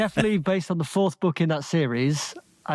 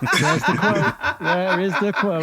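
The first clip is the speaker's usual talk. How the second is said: laughing; 4 semitones higher, slower than usual. Real, not acted. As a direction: laugh out the line.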